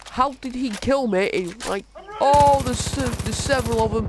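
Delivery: rattled disbelief; flustered outburst. Real, not acted. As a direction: player just killed, blurting in confusion. Player just killed, outraged.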